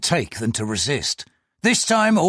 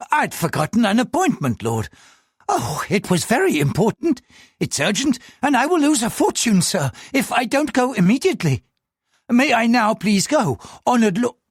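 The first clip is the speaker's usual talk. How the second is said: high, anxious pleading voice; a frightened man answering the police; nervous clerk; 10 semitones higher than usual. Acted. Nervous clerk answers the superintendent in a high, anxious voice.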